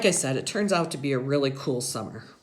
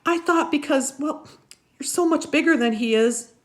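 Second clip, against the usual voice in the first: put-on much higher and louder old lady; grandma's voice; 12 semitones higher, 6 dB louder than usual. A narrator's reading. Grandma reassuring the boy, high and loud. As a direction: coo it high like a fussing grandmother. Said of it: "Graham says".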